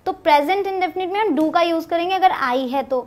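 usual speaking voice

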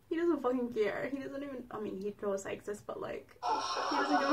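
quiet sulking mumble; sulky mutter. Real, not acted. Sulking under breath.

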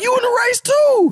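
high, loud incredulous yell; mock shout at a rival mid-race; retold shout. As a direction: shout it across the track in disbelief. Retelling shouting to Bolt mid-race.

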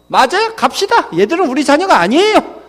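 high, loud huffy agreement; indignant mother. Acted. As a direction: play a wife angrily siding with her husband. Mother siding with angry father. (화난 엄마처럼 높고 크게 맞장구)

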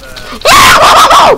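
sudden loud, high scream; startled scream. Real, not acted. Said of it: "Say as you scream, what happened?".

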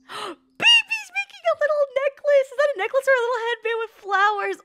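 gushing. Real, not acted. Streamer cooing at baby making a necklace, high gush. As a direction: melt over something cute.

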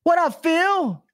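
high-pitched playful holler; excited greeting. Real, not acted. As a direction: hail a buddy in a high voice. Calls out greeting to Phil.

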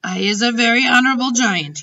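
high, loud dignified declaration; proud giant. A narrator's reading. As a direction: declare it with wounded honour. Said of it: "raised his great head proudly".

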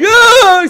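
put-on sudden high shriek of a scared child; mock the kids' scream in one burst; kids screaming. Retelling the scared kids, a sudden mock scream. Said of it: "screaming and freaking out".

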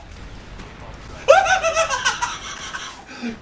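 long, high-pitched laugh; gleeful cackle. Real, not acted. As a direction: laugh wildly in triumph. Gleeful at kills.

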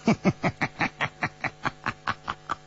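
airy, unvoiced burst of laughter; can't hold back a big laugh; breathy belly laugh. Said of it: "忍不住哈哈大笑起来".

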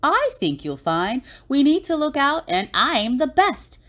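high, self-assured storybook voice; Bonehead. A narrator's reading. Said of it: "till Bonehead said".

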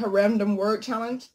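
usual speaking voice

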